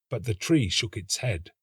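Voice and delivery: ordinary delivery, nothing marked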